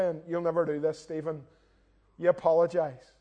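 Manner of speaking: ordinary talk, nothing marked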